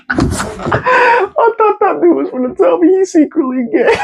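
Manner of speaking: high, slow, dissolving into laughter; words stretched out through real laughter; laughing. Real, not acted. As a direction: laugh your way through the punchline. Cracking up at own guess.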